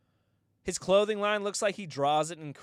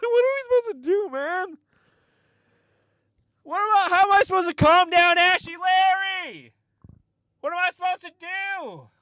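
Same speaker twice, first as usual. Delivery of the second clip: high, loud panicked wailing; 11 dB louder than usual. Retold or imitated. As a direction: wail in helpless panic. Panicked retold self.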